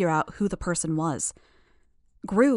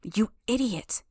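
slow, higher-pitched muttered rebuke; muttered exasperation; 4 semitones higher, slower than usual. A narrator's reading. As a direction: mutter the insult slowly, exasperated, without shouting. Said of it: "I muttered".

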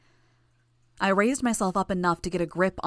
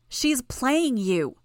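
insistent warning; 6 semitones higher, much slower than usual. A narrator's reading. Imp argues against the narrator.

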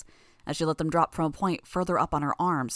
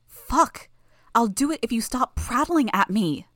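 furious; 6 semitones higher, 5 dB louder than usual. Acted. Sophia forced to agree, furious.

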